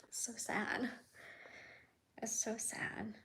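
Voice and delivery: holding back tears, barely voiced; on the verge of tears. Real, not acted. Vlogger grieving a lost animal, near tears. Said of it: "Oh, I'm gonna cry".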